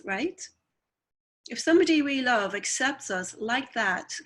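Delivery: normal talking voice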